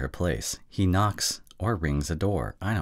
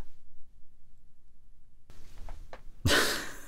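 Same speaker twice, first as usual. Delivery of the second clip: low chuckle after overacting; real, drawn-out chuckle; laughing; 9 dB quieter than usual. Real, not acted. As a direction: break character with a quiet laugh.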